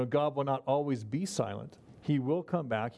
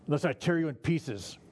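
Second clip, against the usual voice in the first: threatening. Shown as a demonstration. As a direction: lean on "tear" like a real threat. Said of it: "Sounds threatening".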